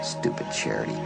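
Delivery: ordinary delivery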